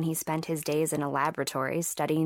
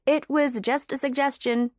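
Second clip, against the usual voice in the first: higher-pitched, quick defensive cut-in; interrupting; 8 semitones higher, 3 dB louder than usual. A narrator's reading. Lily stops Cam's lecture on ground beef, interrupting. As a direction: cut off a lecture in mild protest. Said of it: "she interrupted".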